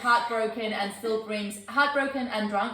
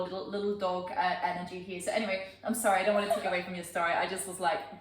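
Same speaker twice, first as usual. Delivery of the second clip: normal talking voice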